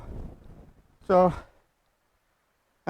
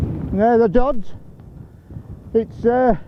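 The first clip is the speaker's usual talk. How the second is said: raised voice against the wind; shouting over wind; 3 semitones higher than usual. Real, not acted. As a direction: call out over the gale. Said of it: "I hope you can hear me over this wind".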